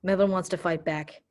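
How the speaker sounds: ordinary delivery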